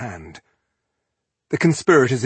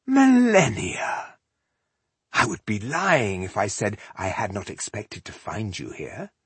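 silky villain greeting. A narrator's reading. Elf greeting the rebel he expected, silky. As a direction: savour her name, then drop to smooth talk.

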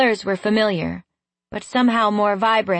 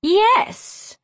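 high, sharp, cutting reply; harsh; 13 semitones higher than usual. A narrator's reading. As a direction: snap it at him in a high voice. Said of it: "the harshness of her voice".

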